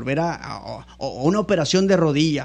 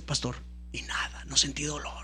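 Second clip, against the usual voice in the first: whispered in quiet amazement, a soft breathy hush; 3 semitones lower, 13 dB quieter than usual. Retold or imitated. Telling the pastor of his healing, whispering in awe. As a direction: drop to a whisper as if the pain is gone. Pressing knee, no pain felt.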